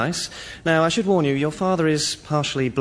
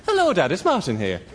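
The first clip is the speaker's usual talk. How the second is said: lilting Irish accent, higher pitch; Irish accent demo; 5 semitones higher than usual. Shown as a demonstration. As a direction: show how the Irish accent should go. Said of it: "like this".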